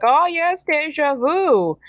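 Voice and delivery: hammed-up villain coo, drawing out deja vu; sly villains. Villains teasing the captive Darkwing, high slow mock-sly voice. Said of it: "And they're like".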